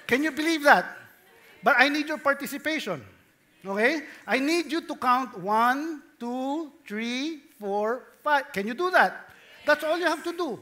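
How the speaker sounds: ordinary talk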